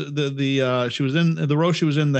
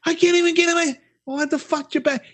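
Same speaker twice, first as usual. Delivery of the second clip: high, whiny complaint; mocking whiny driver; 12 semitones higher than usual. Blocked-in driver complaining about the car behind, a high whine. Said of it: "they're like".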